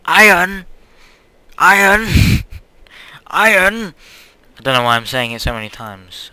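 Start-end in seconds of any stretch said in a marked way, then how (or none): 0.0-3.9 high, loud, giddy exclamations; pitched up and bursting with excitement; excited. Real, not acted. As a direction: crow over a find, shouting it repeatedly. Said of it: "I must be so excited".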